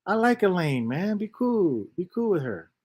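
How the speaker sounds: a hint of a laid-back guy telling him off; imitating Kurt. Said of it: "He's like".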